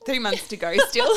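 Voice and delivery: laughing through the words. Real, not acted. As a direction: break into laughter mid-line. Laughing while quoting colleagues.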